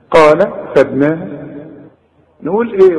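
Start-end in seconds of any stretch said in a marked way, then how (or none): none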